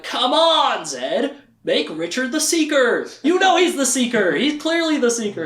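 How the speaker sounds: high, insistent coaxing; pushy parents. Retold or imitated. Recapping the show, speaker voices Zed's parents urging him. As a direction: urge him like nagging ghosts. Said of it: "And they're like".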